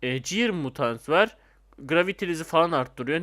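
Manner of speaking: ordinary delivery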